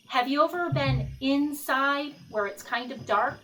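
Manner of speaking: ordinary delivery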